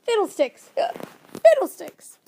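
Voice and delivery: loud, high exasperated outburst; grumbling. A narrator's reading. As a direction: grumble it out forcefully, twice. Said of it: "and the professor grumbled".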